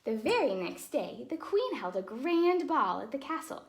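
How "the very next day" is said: ordinary delivery, nothing marked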